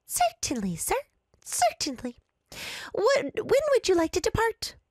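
high chirpy clerk voice; cheerful squeaky desk clerk. Acted. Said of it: "with a smile and a squeaky voice".

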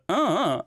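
high, stretched "uh" ending; mock complaining "uh" tacked on; imitated drawn-out "uh". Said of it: "a little bit of the".

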